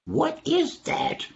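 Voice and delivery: high, slow nervous question; mock alarm. Animals hear a strange noise at night, high nervous question. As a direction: stretch it out in a high worried voice.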